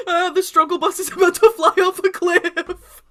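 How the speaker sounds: hammed-up high, loud wail; Sam. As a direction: cry out in mock panic as disaster looms. Mock Sam wailing about struggle bus.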